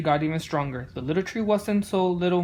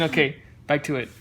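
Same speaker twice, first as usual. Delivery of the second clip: ordinary delivery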